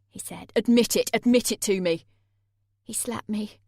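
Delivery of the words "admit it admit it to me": angry. A narrator's reading. Jay demanding.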